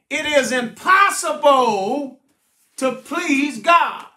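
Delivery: emphatic, driving preacher's delivery; full-force preaching. Acted. Preacher exhorting the congregation to trust with effort, forceful. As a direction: drive each phrase home from the pulpit.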